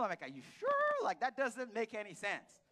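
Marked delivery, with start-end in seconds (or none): 0.2-1.0 incredulous. Retold or imitated. Disbelief at Emmanuel news.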